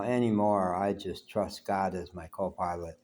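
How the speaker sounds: ordinary talk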